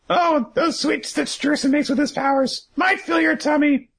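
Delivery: very high, hammed-up character voice; cartoonish high-pitched grumble over food; Big Mom. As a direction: complain in a piercingly high put-on voice. Voices Big Mom's complaint, high.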